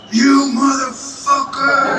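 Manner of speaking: high, drawn-out mock yell; imagined yell. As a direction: yell it long and high, imitating an outraged man. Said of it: "imagine he was yelling".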